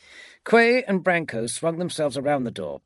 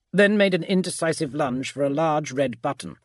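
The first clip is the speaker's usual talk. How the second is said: plain reading voice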